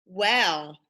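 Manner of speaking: plain reading voice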